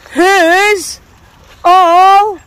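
high, loud rising dog-cheer; excited cheer. Real, not acted. Greeting the dogs, loud high excited cheer. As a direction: whip the dogs up into joy.

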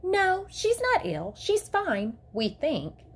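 high-pitched, measured teacher's assurance; professional teacher voice. A narrator's reading. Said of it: "in her most professional-sounding teacher voice".

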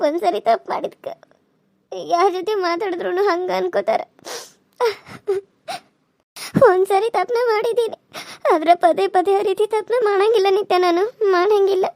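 tearful, sobbing self-defence; acted crying. Pleading with Nitya that the mistake won't be repeated, crying. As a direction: plead through tears, voice catching. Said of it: "ನಾನು ಏನಂತ ಹೇಳಿ ಅಳಾಕತ್ತಿದ್ದಿ".

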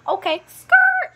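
high, loud, stretched squeal; shrieked comeback. Acted. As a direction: shriek the catchphrase, drawing it out.

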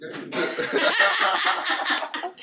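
laugh. Real, not acted. Friends joke about Johnny.

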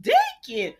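high loud call of Deacon. Real, not acted. Calling out the character's name.

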